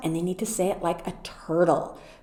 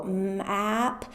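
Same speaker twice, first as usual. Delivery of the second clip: sounds segmented but quicker; quicker sound-by-sound demo; a little faster; 6 dB quieter, slower than usual. Shown as a demonstration. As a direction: speed up the segmenting a bit. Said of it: "a little faster".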